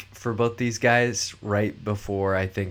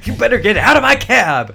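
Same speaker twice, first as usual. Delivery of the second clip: much higher and much louder, laughing; movie trailer voice; 13 semitones higher, 12 dB louder than usual. Retold or imitated. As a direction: shout it like a hammy trailer narrator. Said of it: "Kramer's movie phone".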